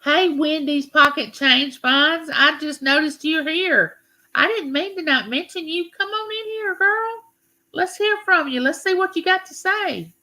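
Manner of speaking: bright, high welcoming call; high sing-song hello to a newcomer; excited welcome. Real, not acted. Greeting a viewer in chat.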